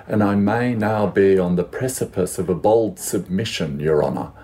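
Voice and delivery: normal talking voice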